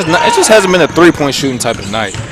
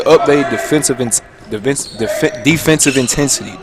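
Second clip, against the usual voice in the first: tripping over the word, restarting; real stumble with false starts; stuttering. Real, not acted. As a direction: stammer through it until it comes out. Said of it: "Dang, I'm stuttering".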